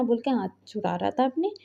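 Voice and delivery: plain reading voice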